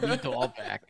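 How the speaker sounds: broad Boston vowels on Bobby Dahlback; Bobby Dahlback, dropped R's, Boston drawl; Boston accent. Shown as a demonstration. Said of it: "good name to say with a Boston accent".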